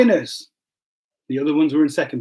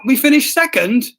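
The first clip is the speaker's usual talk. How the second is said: mock surprise; 7 semitones higher, 5 dB louder than usual. Reading out surprised chat message.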